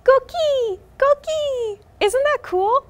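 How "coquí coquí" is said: high chirpy frog call; coqui frog call. Shown as a demonstration. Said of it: "because of the sound they make".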